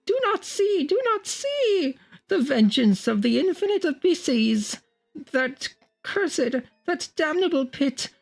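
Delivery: very high, breaking terrified cry; a doomed man crying out as he falls; anguished horror. Acted. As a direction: scream the warning, voice cracking. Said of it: "horror and anguish of a haunted lifetime".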